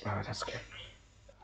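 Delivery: whisper. Real, not acted. Startled player whispering to viewers after a scare, shaken whisper. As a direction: breathe it out after a jump scare.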